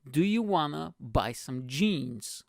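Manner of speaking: slow, spaced-out question; slowly. Retold or imitated. Passenger offering jeans to the storyteller, slowly and deliberately. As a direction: imitate someone spelling out an offer slowly. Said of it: "he says to me slowly".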